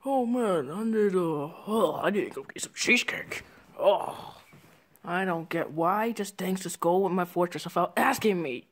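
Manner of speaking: low, weary character grumble; grumbling to self. Acted. Character off to get cheesecake, low grumbling complaint.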